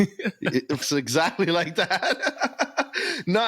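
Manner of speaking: laughing hard, pitched high; words squeezed out through laughter. Real, not acted. Laughing at the impression.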